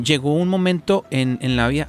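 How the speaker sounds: ordinary delivery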